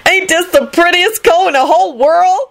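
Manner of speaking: high-pitched excited exclamation; high and thrilled; excited shout. A narrator's reading. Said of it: "she shouted excitedly".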